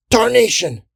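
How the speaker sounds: high, loud pained curse; cry of pain. A narrator's reading. Sergeant poked mid-speech, crying out in pain. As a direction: yell a curse as you're stabbed. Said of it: "he cried out in pain".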